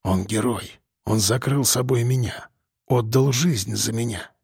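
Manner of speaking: low, soft and mournful; тихо и скорбно; quietly. A narrator's reading. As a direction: speak softly to comfort her. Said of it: "сказал тихо".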